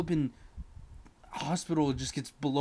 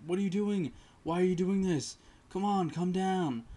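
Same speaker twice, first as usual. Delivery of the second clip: higher pleading voice, slowing at the end; pleading friend; 6 semitones higher than usual. Retold or imitated. Friend begs angry Andrew to come down, higher and pleading.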